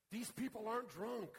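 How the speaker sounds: loud, high declaration with the weight on aren't; bold sermon opener. Shown as a demonstration. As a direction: proclaim These people aren't drunk boldly. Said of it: "Try starting a sermon this way".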